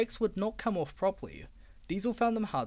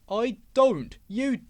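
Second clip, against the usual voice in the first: louder insistent retort; heated quarrel; 5 semitones higher, 7 dB louder, much slower than usual. Acted. Duck arguing with the engines, heated. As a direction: insist back hotly.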